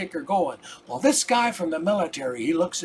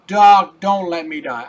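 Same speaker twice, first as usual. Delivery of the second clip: much louder panicked cry on Doc, then dropping back; desperate cry; 8 dB louder than usual. Retold or imitated. A dying patient cries out again to his doctor, panicked.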